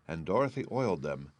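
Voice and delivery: ordinary delivery, nothing marked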